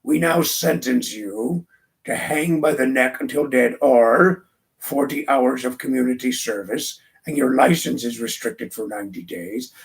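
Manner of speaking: low, solemn sentencing voice; old judge. Acted. Judge sentencing the host in court, low grave judge voice. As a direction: hand down a sentence in a deep judicial register. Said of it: "judge speaking to me like this".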